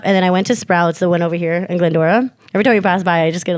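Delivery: ordinary talk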